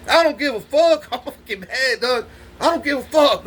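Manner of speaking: ordinary talk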